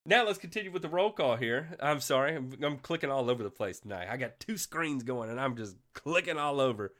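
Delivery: ordinary talk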